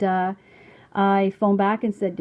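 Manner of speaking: ordinary delivery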